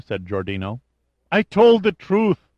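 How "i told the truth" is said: high, loud, desperate protest; wailing to be believed; childlike pleading. A narrator's reading. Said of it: "like the pleas of a child".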